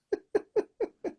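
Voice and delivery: quick high chuckle; brief real laugh; short high laugh. Real, not acted. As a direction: laugh at your own joke. Laughs at the neighbours joke.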